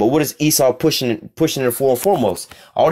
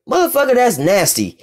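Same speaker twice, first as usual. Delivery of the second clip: pitched way up, grossed-out exclamation; disgusted outburst; 11 semitones higher than usual. Retold or imitated. Preacher voicing his own disgust at an excuse, pitched high. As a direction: recoil at the idea, voice leaping high. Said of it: "I'm like".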